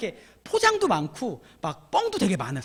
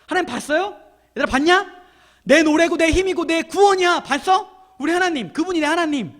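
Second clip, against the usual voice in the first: raised, loud, eager exclamations; excited boasting; 6 semitones higher, 6 dB louder than usual. Acted. Preacher voicing Moses calling to his people, excited boasting. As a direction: crow about your God to friends.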